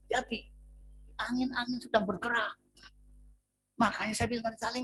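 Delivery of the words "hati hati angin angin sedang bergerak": ordinary talk, nothing marked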